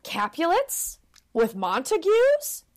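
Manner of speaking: high-pitched frog, drawn-out questions; mock shocked frog squawk; scandalised frog. Frog catches the two lovers together, high scandalised voice. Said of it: "this frog is like".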